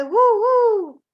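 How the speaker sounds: high, loud woo; owl hoot. Shown as a demonstration. Teacher offering the other owl call, high and loud.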